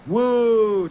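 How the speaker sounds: loud, high "woo"; playful whoop. Pretend. Podcaster jokes about taking Kratom, playful whoop. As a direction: let out a big drawn-out whoop.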